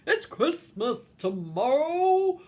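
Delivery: big, slow, loud voice; booming Santa. A narrator's reading. Santa tells reindeer there's no choice, booming slowly. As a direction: boom it out and stretch the words. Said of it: "boomed out Santa's big voice".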